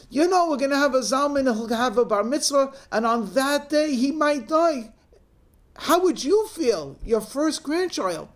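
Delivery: high, overwrought mock anguish; hysterical explaining. Crying daughter explaining imagined death to mother, overwrought. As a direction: plead the case in a theatrical wail. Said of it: "fifteen-year-old crying".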